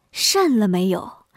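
slow, drawn-out misheard question; 听岔了. Acted. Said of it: "李文平听成了". (压低声音，一字一字拖长)

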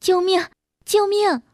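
a woman's frightened cry, voiced by a storyteller; teriakan ketakutan perempuan. A narrator's reading. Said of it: "一个女人恐怖的喊叫声".

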